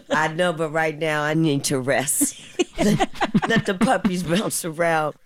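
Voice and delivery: laughing, voice climbing high. Retold or imitated. Veteran actress joking she needs rest from the kids, laughing through it. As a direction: let laughter push the pitch up as you go. Joking quote of own tired reply.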